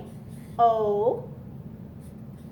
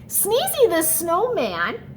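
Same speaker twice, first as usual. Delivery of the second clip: announcing the title; 5 semitones higher, slower than usual. Shown as a demonstration. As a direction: present the title slowly to the children. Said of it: "And here we go".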